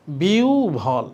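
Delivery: "বিউ ভল" drawn out very slowly in two parts; demonstrated pronunciation. Shown as a demonstration. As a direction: stretch out "বিউ", then land on "ভল". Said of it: "তাহলে কি হচ্ছে?".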